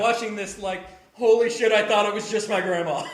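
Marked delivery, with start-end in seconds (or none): 1.1-3.1 higher and much louder, a blurted shocked exclamation; stunned disbelief. Retold or imitated. Recalling seeing grandma's phrases on TV, shocked loud outburst. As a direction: blurt it out like you can't believe what you're seeing.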